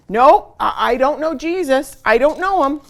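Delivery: loud, high, flustered stammer; panicked denial. Acted. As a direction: blurt it out, caught and scared. Peter's third panicked denial.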